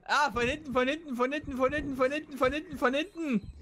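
urgent warning. Real, not acted. Warning partner of attack.

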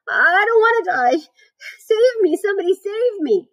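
high, loud, drawn-out wail; panicked scream. A narrator's reading. Wilbur begs the barn to save him, screaming in terror. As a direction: plead for your life in a high squeal. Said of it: "screamed Wilbur".

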